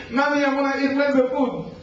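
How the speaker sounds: put-on high, whining little-kid voice begging for food; whiny grown child. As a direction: beg like a spoiled toddler. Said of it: "Imagine a grown person coming".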